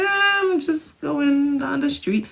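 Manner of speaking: casual. Retold or imitated. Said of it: "you're like".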